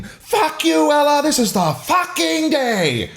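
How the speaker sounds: very high, gleeful drawn-out scream. A narrator's reading. Narrator replays Hope screaming at Ella, screaming. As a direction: scream it out, stretching FUCKING DAY.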